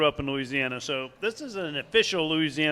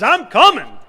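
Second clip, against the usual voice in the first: eager Boudreaux punchline; 7 semitones higher, 11 dB louder than usual. Acted. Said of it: "grabs his life vest and says".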